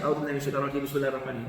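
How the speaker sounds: أعوذ بالله من الشيطان الرجيم run together quickly, carelessly; rushed rote recitation. Retold or imitated. Teacher showing what we're not just saying, rushed rote recitation. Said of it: "So we're not just saying".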